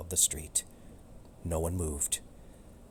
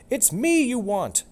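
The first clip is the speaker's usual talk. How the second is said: high, loud cat cry; shout; 8 dB louder than usual. Acted. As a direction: yell to stop the fight. Romeo challenges dog.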